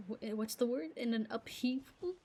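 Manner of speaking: ordinary talk, nothing marked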